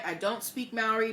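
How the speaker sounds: ordinary delivery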